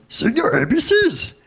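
high, drawn-out puppet voice; Grover. Acted. Puppet on a child's toy microphone, high character voice. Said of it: "and it's Grover, like".

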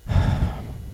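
a long, deep breathy sigh. Shown as a demonstration. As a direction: let out a heavy sigh. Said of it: "with a deep".

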